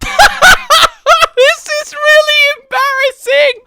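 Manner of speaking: high, loud, slow laughing squeal; laughing embarrassment. Real, not acted. Player losing a long fight, laughing in embarrassment. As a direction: wail it through a burst of laughter. Cracks up repeating his admission.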